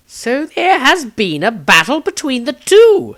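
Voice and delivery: put-on a reedy old wizard observing; Maestro voice. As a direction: wizard Maestro noting the battle, high and loud. Maestro character voice.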